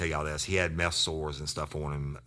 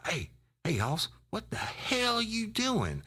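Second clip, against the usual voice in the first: much higher, slow, drawn-out mock Ken on the phone; Ken McMorris; slower than usual. Caller teasing his old partner, high and drawn out. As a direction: stretch it out like a buddy teasing down the line. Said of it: "and it was Ken McMorris".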